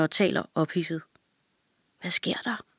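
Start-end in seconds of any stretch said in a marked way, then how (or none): 2.0-2.6 soft unvoiced whisper, barely there. A narrator's reading. Girl whispering to her brother in alarm. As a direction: whisper it so the men won't hear. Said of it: "hvisker hun".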